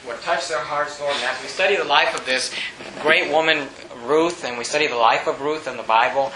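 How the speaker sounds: soft, low prayer voice; hushed earnest prayer. Real, not acted. Pastor prays before sermon.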